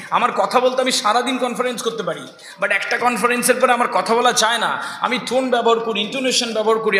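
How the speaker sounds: usual speaking voice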